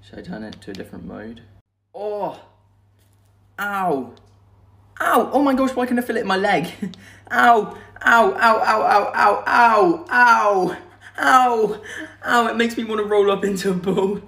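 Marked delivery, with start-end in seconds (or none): 2.0-11.8 sharp yelps of pain; pained ow cries. Real, not acted. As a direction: cry out from the shock. Period simulator shocks, crying out.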